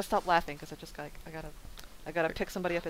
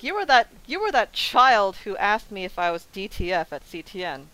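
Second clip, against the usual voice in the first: loud mock scold, raised voice; 9 dB louder than usual. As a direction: scold him loudly. Retelling what she'd say to him.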